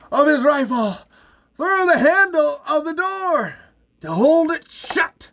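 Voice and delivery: high, punched-out phrases with pauses; excited action narration. A narrator's reading. Narrator as Bert jams the door, excited. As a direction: land each beat of the climax.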